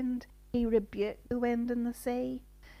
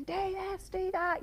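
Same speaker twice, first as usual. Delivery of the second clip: imagined shouting; 10 semitones higher than usual. Pretend. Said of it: "Surely some of them were shouting".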